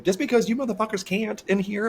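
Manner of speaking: high-pitched, affronted comeback; retold indignation. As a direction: throw it back at them, mock-outraged. Acting out his retort to staff.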